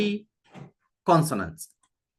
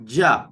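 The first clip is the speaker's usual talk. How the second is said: ja said low and firm; ja, an emphatic lone syllable; stressed syllable; 6 semitones lower, 4 dB louder than usual. Shown as a demonstration. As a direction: land firmly on ja. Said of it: "So there's a stress on".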